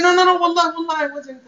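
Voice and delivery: acting out someone caught red-handed; panicked denial. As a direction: protest your innocence in a high voice. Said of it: "And you say".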